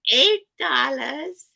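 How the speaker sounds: drawn-out fairy-tale crone; beggar woman voice. Real, not acted.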